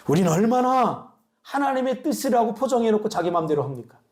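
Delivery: ordinary talk, nothing marked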